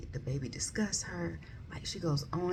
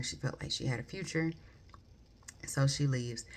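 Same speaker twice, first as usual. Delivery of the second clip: ordinary talk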